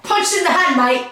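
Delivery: put-on high, loud British accent on mate; cockney British accent. Thomas threatening the man, in a loud, high British accent. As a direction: lean hard into a British accent. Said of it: "British accent".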